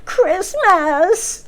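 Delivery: high, drawn-out troll wail rising at the end. A narrator's reading. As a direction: wail it like a pack of little trolls begging. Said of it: "they wailed".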